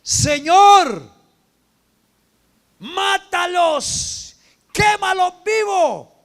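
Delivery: screamed high and loud, slowly; anguished shout. Acted. Stephen under the stones screaming for revenge, loud and high. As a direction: howl it out as if struck by stones. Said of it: "cayó de rodillas y gritó".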